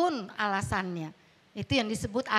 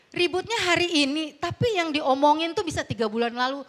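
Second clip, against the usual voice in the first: ordinary talk, nothing marked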